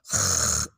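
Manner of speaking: ख as a low raspy scrape in the throat. Shown as a demonstration. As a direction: clear the throat on ख. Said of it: "ऐसे करना होता है".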